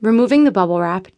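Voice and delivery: plain reading voice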